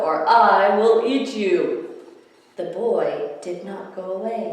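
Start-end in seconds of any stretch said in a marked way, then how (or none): 0.0-1.9 loud, slow, drawn-out threat; bellowed. A narrator's reading. Theodore threatens the pesky boy, slow loud bellow. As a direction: boom each word as a warning. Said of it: "he bellowed".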